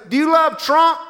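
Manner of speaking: high, booming rally question; Donald Trump. Retold or imitated. Trump demands an answer from Willie onstage, loud impression. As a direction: fire the question loudly over a crowd.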